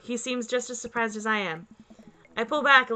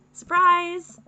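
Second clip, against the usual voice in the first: cheerful shout; 7 semitones higher, 8 dB louder than usual. Acted. Surprise party reveal.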